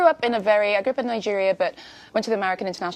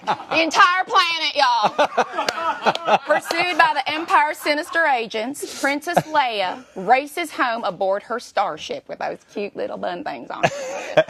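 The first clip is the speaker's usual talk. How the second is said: put-on higher, louder Southern US accent; Southern drawl; 6 semitones higher, 3 dB louder, slower than usual. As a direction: ham up a Southern drawl, y'all. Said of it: "Southern United States".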